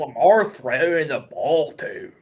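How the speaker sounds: put-on slow drawn-out Baltimore accent; a slow, deliberate Baltimore fan's drawl. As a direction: stretch each word like a Baltimore local. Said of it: "talk really slow when you're doing Baltimore".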